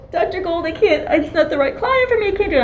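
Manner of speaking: high, wailing plea; mock bawling. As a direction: imitate a rookie bawling to the supervisor. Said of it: "I start bawling".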